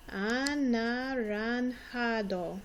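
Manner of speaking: "Anaranjado" drawn out slowly, J like an H, "ah-nah-rahn-HAH-doh"; slow model word. Shown as a demonstration. Teacher slowly modelling "Anaranjado" for pupils to repeat. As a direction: stretch each syllable of "Anaranjado" for the kids. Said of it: "try and say it with me".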